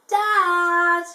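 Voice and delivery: loud, high chick call; joyful chick cry. Acted. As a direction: shout out to dad. Chick spots its father.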